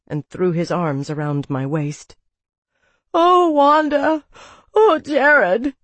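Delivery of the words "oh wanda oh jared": high, loud anguished wail; sobbing. A narrator's reading. Jamie running into Wanda's arms in grief, sobbing. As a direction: cry out the names, drawn out. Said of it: "he sobbed".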